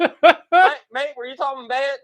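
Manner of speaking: laughing, high and hammed-up Aussie; mock Australian accent. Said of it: "Am I doing the right Australian accent".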